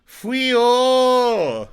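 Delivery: a loud, high comic exclamation in a put-on character voice; Uncle Roger impression. Gushing about a comedy cooking channel, a loud mock Uncle Roger cry. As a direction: bellow Fui-o like a comedian mocking bad cooking. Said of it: "favorite cooking channels is Uncle Roger".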